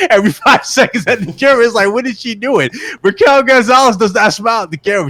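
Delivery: amused incredulous rant; laughing disbelief. Real, not acted. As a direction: laugh at the absurdity while you talk. Mocking Raquel smiling, laughing.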